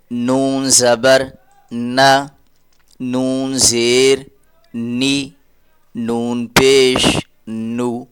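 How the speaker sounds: slow, drawn-out letter drill; slow teaching drill. Shown as a demonstration. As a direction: spell each sound out slowly for children. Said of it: "teacher drilling letter with vowel marks". (آہستہ تدریسی مشق)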